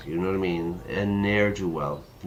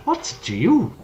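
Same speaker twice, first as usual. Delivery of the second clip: put-on girl voice; 3 dB louder than usual. Voicing the girl.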